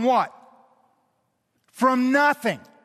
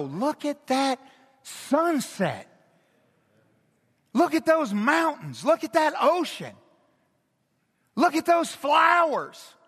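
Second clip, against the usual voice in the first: rising wonder; 4 semitones higher than usual. Retold or imitated. Preacher voicing praise of creation, building excited wonder. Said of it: "created by God to go".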